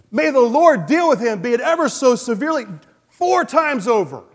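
high, loud outraged verdict; furious king demanding punishment; indignant judgment. Acted. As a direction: pronounce the sentence with righteous fury. David interrupts with harsh judgment.

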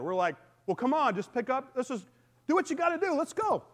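ordinary delivery

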